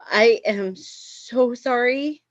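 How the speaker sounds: very slow, high, strained sorry; drawn-out apology. Acted. Guest apologizing after vomiting on a friend, slow and high. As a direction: stretch out the mortified apology.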